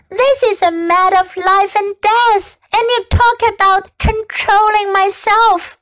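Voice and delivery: put-on heated child's protest; outraged yelling. A narrator's reading. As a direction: argue for the pig's life. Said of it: "yelled Fern".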